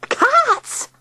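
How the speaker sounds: very high shriek. A narrator's reading. Tilly shrieking at Digby about the cats. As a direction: shriek it, baffled. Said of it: "shrieked Tilly".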